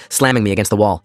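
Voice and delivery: plain reading voice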